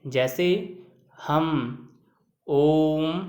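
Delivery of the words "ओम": ओम with the ओ held long, three times its usual length; drawn-out vowel. Shown as a demonstration. Teacher showing students a long pluta vowel, drawn out. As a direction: stretch the ओ of ओम like a chant. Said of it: "का उच्चारण करते हैं".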